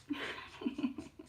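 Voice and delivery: airy little laugh; a quiet breathy chuckle; soft breathy laugh. Real, not acted. Laughs at own shaky hand.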